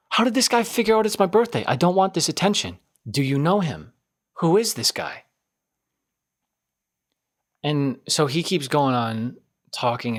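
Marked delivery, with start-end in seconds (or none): 0.1-5.2 panicked thoughts. Retold or imitated. Panicked inner thoughts about birthday.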